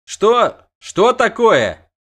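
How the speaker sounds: high loud slowed cry; high loud startled question; startled shout. A narrator's reading. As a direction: demand what he sees. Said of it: "крикнул Лютик".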